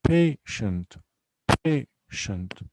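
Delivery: "Patient" broken into pa, tient; two separate syllables. Shown as a demonstration. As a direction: clear gap between the syllables of "Patient". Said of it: "only two syllables here".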